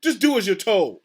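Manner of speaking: loud, bossy command, falling firmly at the end; barked order. A narrator's reading. Mister Payne ordering the captain to obey, barked order. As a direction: lay down the law. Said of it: "barked Mister Payne".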